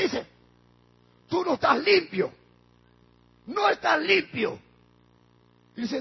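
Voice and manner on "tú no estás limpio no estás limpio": loud, raised rebuke; insistent accusation. Retold or imitated. Wife insisting to her husband that he is tainted, accusing. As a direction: drive the charge home, high and forceful.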